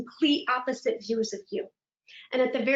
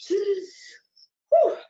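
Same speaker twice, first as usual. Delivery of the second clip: shaky sigh; 9 semitones higher, 5 dB louder than usual. Retold or imitated. Reacting to a roach.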